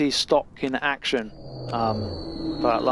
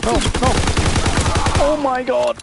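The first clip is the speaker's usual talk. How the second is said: alarmed; 10 semitones higher, 3 dB louder, slower than usual. Real, not acted. Player about to die in match.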